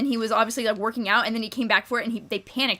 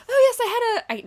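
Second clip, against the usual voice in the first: bad Irish accent; 13 semitones higher, 3 dB louder than usual. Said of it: "I'm a terrible Irish accent".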